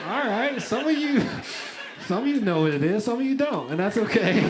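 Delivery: high, loud laughing address to the crowd. Real, not acted. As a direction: tease the crowd through a big laugh.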